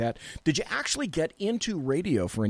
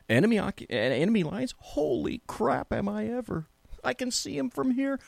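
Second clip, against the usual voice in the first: mock shock; 10 semitones higher than usual. Exaggerated outrage at Sacramento.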